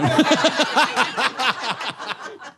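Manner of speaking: high burst of laughter. Real, not acted. Laughing at the claim.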